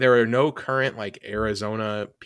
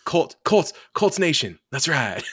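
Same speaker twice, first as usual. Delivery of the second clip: higher, louder slogan delivery; hammed-up team slogan; mock catchphrase; 5 semitones higher, 4 dB louder, slower than usual. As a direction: lightly parody a quarterback's rallying slogan, drawn out. Mimicking quarterback's catchphrase with other teams.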